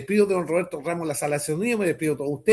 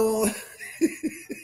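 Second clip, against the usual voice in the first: high, rising giggle; short high cackle; chuckling laugh; 8 semitones higher than usual. Real, not acted. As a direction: giggle at your own joke. Laughs at own joke.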